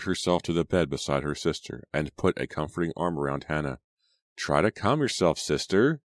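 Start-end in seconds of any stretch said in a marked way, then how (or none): none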